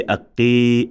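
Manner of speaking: "aqqi" with the final i held long; wrongly extended vowel. Shown as a demonstration. Teacher showing the mistake, "aqqi" drawn out at the end. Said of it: "No, can we say".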